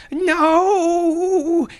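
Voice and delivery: very high, long wailed NO; anguished cry. Acted. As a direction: scream it out in agony, drawn out. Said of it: "he cried".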